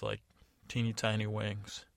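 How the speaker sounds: ordinary delivery, nothing marked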